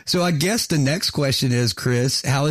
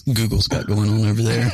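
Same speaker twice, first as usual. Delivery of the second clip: giggles bursting out at the end; can't hold back the laugh. Real, not acted. Stifled laughter breaking through.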